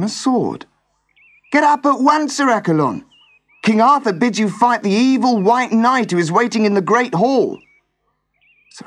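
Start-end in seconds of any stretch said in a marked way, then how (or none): 1.5-7.6 much higher, louder urgent servant. Acted. Servant rouses sleeping Sir Accolon to fight, high and urgent. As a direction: shake him awake, hurried and pressing.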